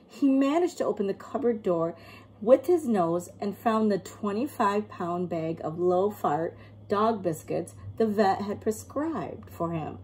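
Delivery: ordinary delivery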